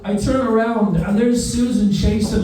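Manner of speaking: ordinary delivery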